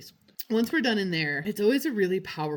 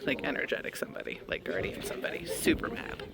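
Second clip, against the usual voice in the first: whispered and hushed, telling a secret; eerie whisper; 3 semitones lower, 3 dB quieter than usual. Real, not acted. Vlogger sensing an angry guardian in the dark room, whispered. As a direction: whisper it like something spooky is near.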